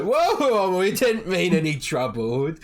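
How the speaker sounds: high, loud, slow nervous yokel voice; startled villager. Acted. Rowdy drinker backing off from armed adventurers, high and nervous. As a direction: squeak out a nervous apology.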